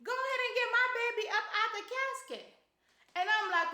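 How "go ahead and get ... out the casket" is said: mama yelling. Retold or imitated. Mama yelling at her sons, high and fast. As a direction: bark it like a fed-up mama. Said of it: "and she yells".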